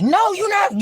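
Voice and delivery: high loud struggling shout; fighting shout. Retold or imitated. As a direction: push him off, shouting. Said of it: "Kathy was fighting like".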